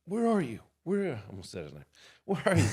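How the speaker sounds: retold frantic yell; frantic call. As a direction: shout down the phone at him. Frantic call to missing friend.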